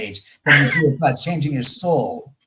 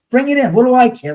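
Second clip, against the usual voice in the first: higher, louder dare to the spirits; defiant challenge; 8 semitones higher, 7 dB louder, faster than usual. Retold or imitated. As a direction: call them out, daring. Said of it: "and I was like".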